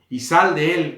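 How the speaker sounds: loud, shouted order to get out; Jesús. Acted. Jesus ordering demons out of a man, shouting loudly. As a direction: call it out as a firm command. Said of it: "gritando, le dijo en alta voz".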